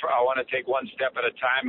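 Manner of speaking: ordinary talk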